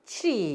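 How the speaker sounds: 트리 as "chri", one blended glide; model pronunciation. Shown as a demonstration. Teacher drilling students on tree, model pronunciation. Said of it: "이렇게 발음하세요".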